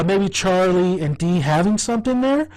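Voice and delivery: ordinary talk, nothing marked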